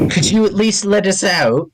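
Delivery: a hint of a meek prisoner begging; pleading prisoner.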